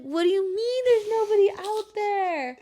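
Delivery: high, loud and slow, incredulous; outraged disbelief. Retold or imitated. Mother in labor disbelieving her partner, high loud outraged question. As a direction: demand an explanation in disbelief.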